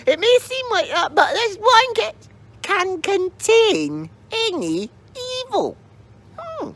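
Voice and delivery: very high, cryptic crone voice; mysterious old lady. Acted.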